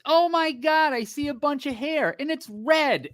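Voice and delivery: high, excited gasp of a nurse; surprised nurse. Retold or imitated. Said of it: "the nurse goes".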